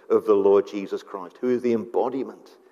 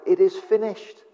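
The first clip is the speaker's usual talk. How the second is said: cried out; 7 semitones higher than usual. Retold or imitated. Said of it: "cried out".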